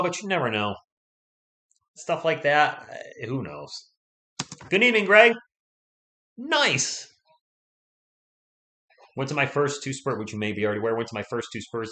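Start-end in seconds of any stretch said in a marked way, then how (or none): 4.7-7.1 loud, high welcome to a viewer; bright loud shout-out to the chat; excited greeting. Real, not acted. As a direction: call out the hello high and loud.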